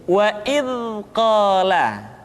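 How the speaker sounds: "Wa id qala" with buzzing th flowing at end of "id"; softly flowing dza. Shown as a demonstration. Teacher giving the correct form, flowing sound. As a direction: let "id" hum softly into "qala". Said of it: "suara dialirkan dengan lembut".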